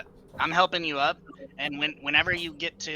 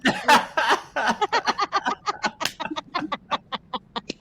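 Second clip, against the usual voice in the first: loud, high, sustained laughter; long loud laugh; 10 dB louder than usual. Real, not acted. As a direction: crack up for seconds. Player laughs long and hard.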